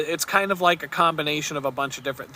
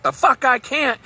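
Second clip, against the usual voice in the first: defiant retort; 8 semitones higher than usual. Retold or imitated. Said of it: "He's like".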